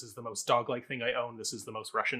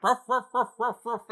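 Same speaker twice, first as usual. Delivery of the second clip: high, loud playful dog barks; barking like a dog; 11 semitones higher, 6 dB louder than usual. Retold or imitated. Barking at the host as a dog, high and loud. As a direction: yap it out like a silly puppy. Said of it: "speak the whole thing in dog".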